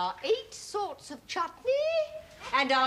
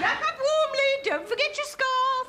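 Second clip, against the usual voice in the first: very high, loud motherly call; 5 dB louder than usual. Acted. Mother to guest leaving for the pub, calling out. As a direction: call after someone leaving the house.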